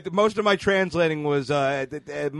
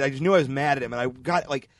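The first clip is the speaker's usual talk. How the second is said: usual speaking voice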